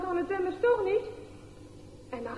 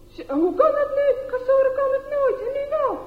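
put-on disbelieving boys; 11 semitones higher, 6 dB louder than usual. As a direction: hold on, how is that possible.

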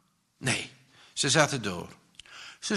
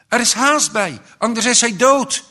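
loud, high pleading call for help; urgent shout; 9 semitones higher, 8 dB louder than usual. A narrator's reading. Mother begs the emergency line to hurry, shouting. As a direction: shout that every second counts. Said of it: "riep ze".

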